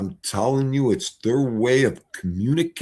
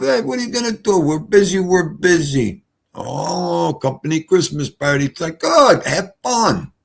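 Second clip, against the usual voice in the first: mocking family; 7 semitones higher than usual. Mimicking family going out.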